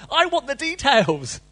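high, loud, slow demand; preacher insisting on details. Pretend.

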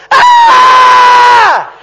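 sudden high, loud cry of despair; high piercing scream of release; anguished scream. Retold or imitated. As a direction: let it all out in one wail. Breaking down once home.

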